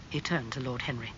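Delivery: usual speaking voice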